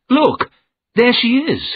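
sudden high loud cry of alarm; urgent calling out. Acted. Narrator to Mister Jerome, seeing the woman, high loud alarm. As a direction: point and shout, startled. Sees the ghost woman by grave.

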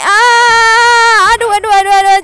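loud high panicked wail. Real, not acted. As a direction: cry out while losing grip. Falling in tug of war.

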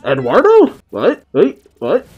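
high, loud shocked outburst. Real, not acted. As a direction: react to a big twist. Shocked by a surprise reveal.

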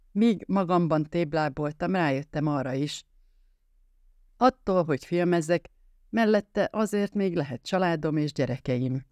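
plain reading voice

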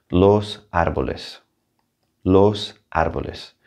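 "Los árboles" with ES ending, stress on ár; Spanish plural. Shown as a demonstration. Teacher drills "Los árboles" plural for students. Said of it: "we have to add an E S".

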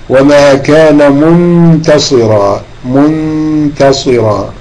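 منتصرا drawn out very slowly, the نون hidden with a light nasal hum; slow tajweed demo. Shown as a demonstration. Teacher slowly models منتصرا for students, drawn-out nasal ikhfa. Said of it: "إخفاء".

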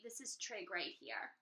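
ordinary delivery

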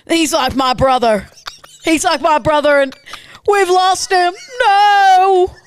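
distraught fighter pilot. Acted. Pilot radioing in a downed wingman, increasingly distraught. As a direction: call it in urgently, then break into grief. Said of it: "ace fighter pilot that's just watched your wingman get shot down".

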